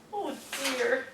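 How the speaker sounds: usual speaking voice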